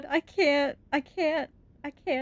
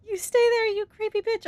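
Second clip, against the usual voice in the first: repeated much higher, squeaky and mocking; mock squeak; 9 semitones higher than usual. Mocking the creepy monster in a squeaky voice. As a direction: squeak it out as a joke.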